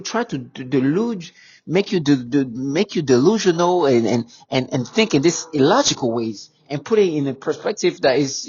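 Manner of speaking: usual speaking voice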